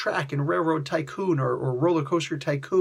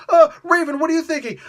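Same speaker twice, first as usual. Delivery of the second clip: high, mocking teammate voice; mock whiny voice; 9 semitones higher, 5 dB louder, faster than usual. Critic mimics teammates fussing over Raven, high and mocking. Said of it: "mocking the team's lines".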